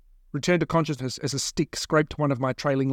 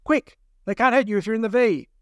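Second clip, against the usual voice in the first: high, loud urgent yell; urgent shout; 7 semitones higher, 3 dB louder than usual. Acted. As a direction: shout over the gunfire. Eve shouting during escape.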